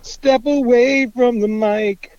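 slow, high, drawn-out echo; mock announcer echo. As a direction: stretch it out like a PA voice. Mocking echo of partner.

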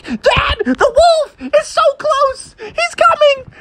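very high, drawn-out wailing shriek; terrified Froggy. Acted. Froggy warns Dad wolf is close, terrified. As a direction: wail the warning in hammed-up panic. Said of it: "and Froggy was like".

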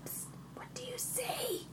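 very soft, slow stealth whisper; in-game whisper. Pretend. Player whispering to a fellow sneaking adventurer, hushed whisper. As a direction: hiss a secret question to a companion.

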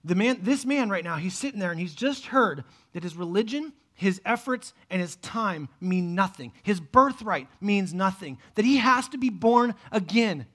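ordinary delivery

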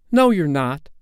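put-on high, loud boyish contradiction; stubborn child's loud protest; defiant denial. A narrator's reading. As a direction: flatly refuse to believe it. Peter refuses the truth.

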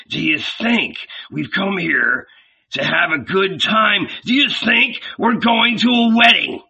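mock the idea of a party; scornful scolding. Judas scolds Peter.

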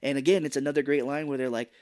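ordinary talk

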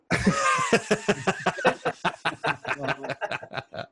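long, high-pitched belly laugh. Real, not acted. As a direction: crack up at the punchline. Cracking up at punchline.

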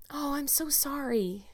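put-on sorrowful, drawn-out girlish apology; penitent. A narrator's reading. Anne apologises to Marilla for the pie, penitently. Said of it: "said Anne penitently".

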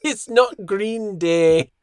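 slow, high insistence on "It's not Green Day"; insistent comic denial, very drawn out; emphatic correction. Real, not acted. Repeats correction amid laughter.

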